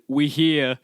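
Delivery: bored conductor announcement. Retold or imitated. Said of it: "the lady just said".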